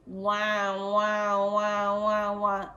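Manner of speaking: repeated mock baby cries, wah wah; mimicking a crying infant in the night; imitated baby wail. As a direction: wail like a newborn waking at night.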